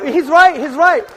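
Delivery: loud, rising shout over applause; shouting over the crowd. Real, not acted. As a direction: raise your voice to cut through the noise.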